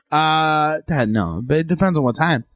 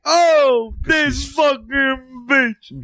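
drawn-out outraged shout; mock outraged shout; 8 semitones higher, much slower than usual. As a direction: yell it in fake outrage. Mock outrage at wife joke.

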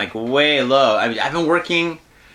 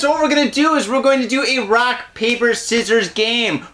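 announcer; 7 semitones higher than usual. Acted. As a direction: pump up the audience like a host. Hosting the show intro.

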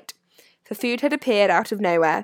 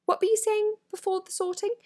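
put-on much higher, light girl's voice; Hermione; 13 semitones higher than usual. A narrator's reading. Hermione questions the ghost, high-voiced. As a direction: ask curiously in a high voice. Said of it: "Hermione asked the ghost".

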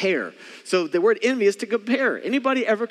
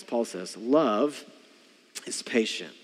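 ordinary talk, nothing marked

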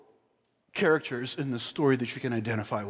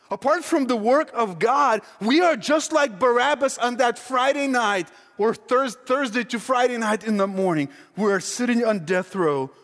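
fervent preaching; 8 semitones higher, 4 dB louder than usual. Acted. Preaching peak.

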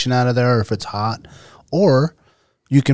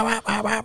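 high, softer repeated bahs; imitated engine noise; 10 semitones higher, 8 dB quieter than usual. Storyteller showing the broken ATV's racket, engine imitation. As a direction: mimic the motor popping away in high bahs. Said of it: "like the sound of the engine going".

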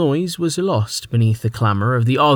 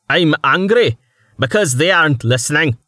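loud, higher angry retort; angry snap; 5 semitones higher, 3 dB louder than usual. Acted. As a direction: snap back at them. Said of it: "Starvo snapped".

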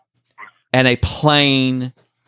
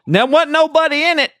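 higher-pitched country farmer, lightly imitated; lightly voiced farmer; 13 semitones higher than usual. Farmer to firefighters about a find, lightly imitated. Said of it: "this farmer waves us down".

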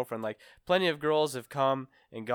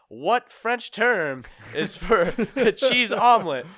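game show host; 9 semitones higher, 8 dB louder, slower than usual. Retold or imitated.

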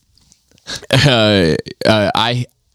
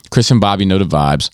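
ordinary delivery

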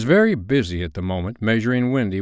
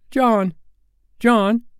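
high, loud calling of a name; plaintive high-pitched call; child's cry; 14 semitones higher, 4 dB louder than usual. A narrator's reading. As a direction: call out like a small boy waking his brother. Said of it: "Michael would cry".

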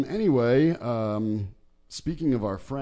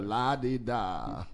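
put-on slow, teetering posh old Brit; drawn-out lah-di-dah, old gent; wobbly elder British voice; slower than usual. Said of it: "the wobbly elder British voice".

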